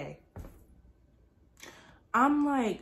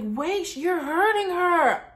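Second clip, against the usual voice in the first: higher, louder drawn-out protest; imitated protesting mother; 8 semitones higher, 4 dB louder, slower than usual. Mother to intern hurting her daughter, raised protesting voice. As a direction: call it out to stop someone hurting your child.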